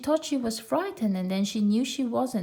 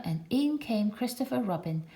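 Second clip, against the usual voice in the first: ordinary delivery, nothing marked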